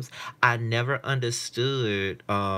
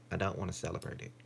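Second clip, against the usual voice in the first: ordinary talk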